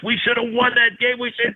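high mock coach voice, pounding the desk; a gruff old coach fuming over a lost game; hammed-up angry coach; imitating angry Bo.

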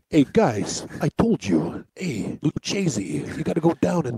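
low, soft mobster growl. Acted. Joking about a mob-sounding name, low mobster voice. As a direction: lean in like a mob boss making a threat. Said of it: "sound like any more like a mob name".

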